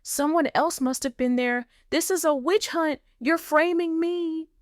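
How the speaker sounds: high, panicked denial; panicky rising pitch. Acted. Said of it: "her voice rising in pitch".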